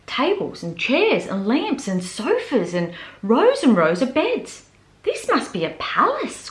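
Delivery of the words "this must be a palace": gasping in awe. Acted. Small bear amazed by the furniture floor, awed. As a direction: breathe it out in wonder. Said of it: "Corduroy gasped".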